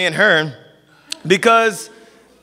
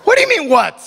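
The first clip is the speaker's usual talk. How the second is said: put-on clueless kid. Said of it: "they're just like".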